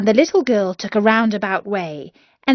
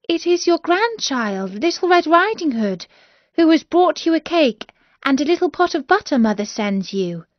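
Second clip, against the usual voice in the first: put-on much higher young girl voice; a sweet girl announcing herself, high; a girl at grandmother's door, much higher; little girl; 8 semitones higher than usual.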